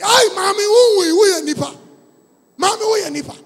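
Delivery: high, loud frightened shout; startled shout. Retold or imitated. Mortuary attendant shouts in fright at the woman who woke up. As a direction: yell it in shock at the waking woman.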